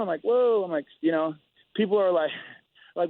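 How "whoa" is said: higher, louder recoiling whoa; startled whoa. Retold or imitated. Fighter taken aback by friendly crowd, startled loud whoa. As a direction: jump back, caught off guard. Reacting to friends after training.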